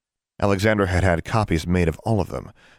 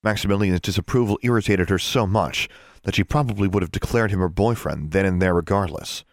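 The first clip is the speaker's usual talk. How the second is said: ordinary delivery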